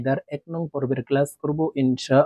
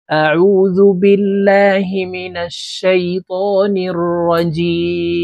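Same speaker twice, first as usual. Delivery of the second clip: slow, drawn-out chanted Arabic recitation; 5 semitones higher, 7 dB louder, much slower than usual. Acted. Teacher opening the Quran class, chanting slowly. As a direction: intone it slowly, reverently.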